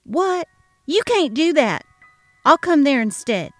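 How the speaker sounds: shocked, then loud and insistent; outraged. Acted. Sharon outraged at the cancelled visit.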